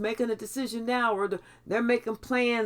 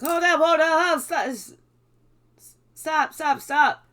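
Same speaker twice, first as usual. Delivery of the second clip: higher and louder, cutting them off; commanding stop; 5 semitones higher, 6 dB louder than usual. Retold or imitated. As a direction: halt the rambling firmly. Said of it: "Spirit was like".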